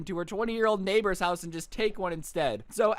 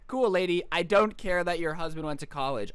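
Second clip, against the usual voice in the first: usual speaking voice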